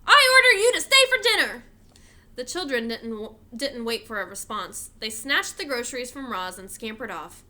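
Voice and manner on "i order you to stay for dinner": high, loud bossy demand; girl ordering the robot around, loud and high; very improper voice. A narrator's reading. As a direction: bark the order like a little tyrant. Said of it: "In a very improper voice".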